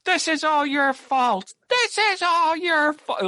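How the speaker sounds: high-pitched, drawn-out mock wailing; terrible overacted emotion. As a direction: imitate a bad actress in hysterics. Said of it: "might be worse than Seagal's acting".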